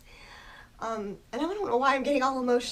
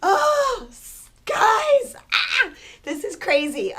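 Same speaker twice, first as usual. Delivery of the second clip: sudden high, loud sobbing cry; crying outburst; 10 semitones higher, 11 dB louder, slower than usual. Real, not acted. Reader overwhelmed by her own reading, a loud crying outburst. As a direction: let it burst out in a loud wail.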